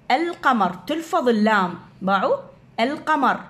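القمر said al-qamar, the lam clearly sounded; moon letter pronunciation. Shown as a demonstration. Teacher to pupils showing the lam is pronounced.

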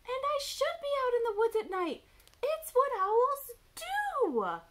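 very high, loud owlet protest; indignant little owl at full volume; shouting Baby Owl. A narrator's reading. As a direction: shout back, drawing out the last words. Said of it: "shouted Baby Owl".

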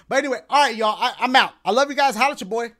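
loud sign-off. Real, not acted.

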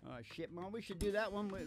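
ordinary talk

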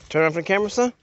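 dad loudly calling his son over for the camera. Real, not acted. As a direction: call it out across the trail.

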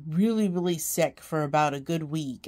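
ordinary talk

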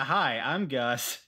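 usual speaking voice

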